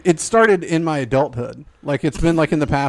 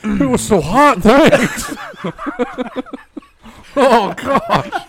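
bear voice; 7 semitones higher, much slower than usual. Pretend. Voicing the trapped bear.

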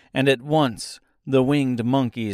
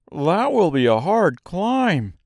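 higher-pitched storybook Scarecrow; Scarecrow's voice; 6 semitones higher, 4 dB louder than usual. Acted. Scarecrow figure speaks in story.

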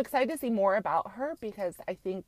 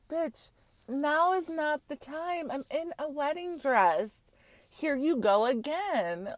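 high, slow, indignant bride telling her off; mock diva outburst; 7 semitones higher, slower than usual. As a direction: put her in her place from the wedding dress. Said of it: "I would have been like".

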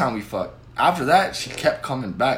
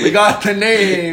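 laughing triumph; 5 semitones higher, 7 dB louder, much slower than usual. Real, not acted.

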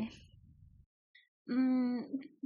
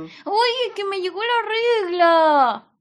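loud, rising, nearly crying; shouting it out; 5 semitones higher, 12 dB louder than usual. Acted. Girl shouts to the teacher over the children, almost crying. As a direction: blurt it out over the noise, almost in tears. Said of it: "Hable fuerte, no la escucho".

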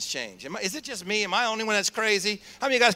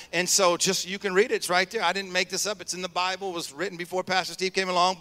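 normal talking voice

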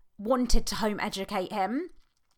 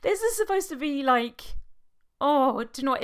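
choked up; 5 semitones higher than usual. Real, not acted. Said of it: "I'm trying not to cry".

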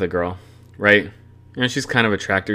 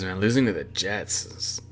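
ordinary talk, nothing marked

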